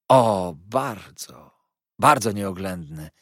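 slow, loud drawn-out opening in mock regret; deep sigh. A narrator's reading. Heep lamenting his employer's imprudence, sighing deeply. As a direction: sigh heavily over the words. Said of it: "odrzekł z głębokim westchnieniem".

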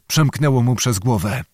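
ordinary delivery

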